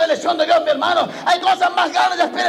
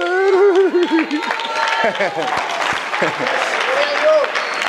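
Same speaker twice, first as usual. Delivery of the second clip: long laugh; 4 semitones higher than usual. Real, not acted. Preacher laughs at own mimicry.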